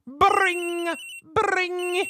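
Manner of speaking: high, bright mock phone ring; phone ring imitation. Imitating the phone ringing in the game, high and bright. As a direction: sing the ring tone. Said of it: "had to say it myself".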